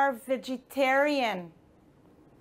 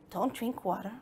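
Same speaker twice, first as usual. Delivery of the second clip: softly questioning, quieter; doubtful question; 4 semitones lower, 8 dB quieter, faster than usual. Shown as a demonstration. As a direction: wonder aloud, low and soft.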